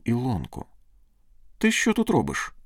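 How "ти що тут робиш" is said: high-pitched delighted surprise; joyful exclamation. Acted. Man surprised to find his sweetheart, joyful high exclamation. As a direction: exclaim it, unable to hide the joy. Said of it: "вигукнув він, не в змозі приховати свою радість".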